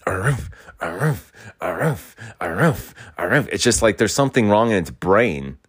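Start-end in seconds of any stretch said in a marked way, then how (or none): 0.1-3.5 repeated "aroof" barks, each swooping; imitated dog bark. Mocking the dog next door that won't stop, imitated barks. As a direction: mimic a dog barking over and over.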